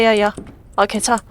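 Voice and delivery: ordinary talk, nothing marked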